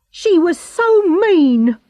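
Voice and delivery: man crying out angrily about the cruel woman; angry cry. A narrator's reading. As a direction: spit it out in fury. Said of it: "he cried angrily".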